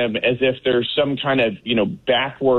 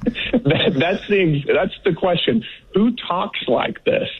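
high, disbelieving, and slow on the last question; can't believe anyone talks like this; incredulous; 7 semitones higher, slower than usual. Real, not acted. Guest answers the host's mocking question, incredulous.

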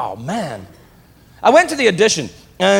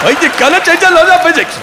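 higher, louder garbled Scottish impression; mock Scotty accent; 10 semitones higher, 6 dB louder than usual. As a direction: parody Scotty as incomprehensible. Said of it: "Scotty in Star Trek".

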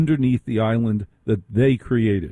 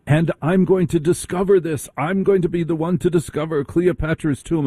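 Hawass; 7 semitones higher than usual. Retold or imitated. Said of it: "I've caught him on a couple of occasions saying".

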